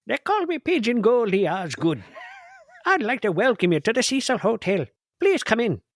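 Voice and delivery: high Irish leprechaun voice. Pretend. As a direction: play a cheeky leprechaun. Goldie speaking in a leprechaun voice.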